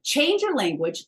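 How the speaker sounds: usual speaking voice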